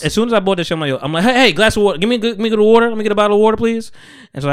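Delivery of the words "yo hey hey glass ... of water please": louder, higher call for water; hammed-up thirsty plea at the counter; urgent plea. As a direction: call out desperately for a drink. Begging for water after the blood draw.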